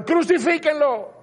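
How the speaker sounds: high-pitched imitated mob cry; crowd's shout. Preacher voices the crowd that turned on Jesus, imitated shouting. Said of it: "y mañana gritaban".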